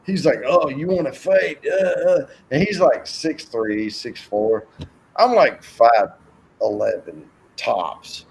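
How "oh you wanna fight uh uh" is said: retold hefty challenger. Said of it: "like, hefty with".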